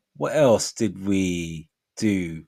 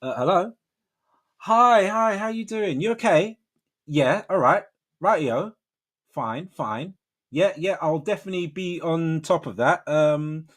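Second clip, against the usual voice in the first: higher, polite professional phone manner; work call voice; 6 semitones higher than usual. Shown as a demonstration. Worker reassuring a caller on a work call, work voice. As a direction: switch into an obliging office-call voice. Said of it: "and the work call be up".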